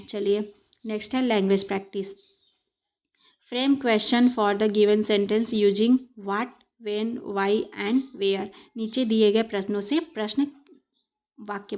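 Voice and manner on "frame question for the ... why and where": drawn out word by word; slow instruction reading. Shown as a demonstration. Teacher reading the question-framing task, very slow. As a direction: read the task slowly for pupils. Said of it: "reading the exercise instruction".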